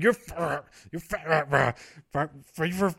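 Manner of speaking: mock furious stutter, stuck on fi; a hammed-up boss so angry the word won't come out; stammering with rage. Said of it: "he's, like, so angry that he can't".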